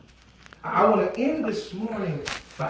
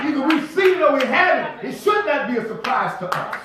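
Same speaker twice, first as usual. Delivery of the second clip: impassioned preaching; 5 semitones higher than usual. Real, not acted. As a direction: pitch lifted, insistent preacher. Preaches at full force.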